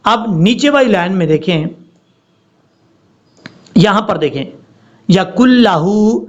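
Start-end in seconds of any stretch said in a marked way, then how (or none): 5.1-6.2 slow, chanted recitation of the verse; slow tajweed chant; Quran recitation. Shown as a demonstration. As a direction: stretch یکن لہو and hold each letter. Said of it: "اب کئی لوگ ایسا بھی".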